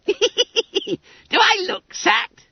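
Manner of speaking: very high, slow squeal; gleeful Billy Bunter. A narrator's reading. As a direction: stretch it out in a squeaky, crowing boy voice. Bunter gleefully mocks the others' doubts.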